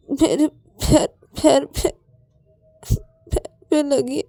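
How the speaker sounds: high, halting tearful answer; whimpering in pain; crying. Acted. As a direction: get it out between sobs. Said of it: "तुम, तुम रो क्यों रहे हो".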